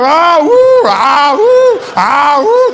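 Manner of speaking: loud wail flipping to high "woo"; frantic put-on sobbing, loud and high; mock alternating cries. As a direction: seesaw between bawling and hooting. Child alternates cries to please parent.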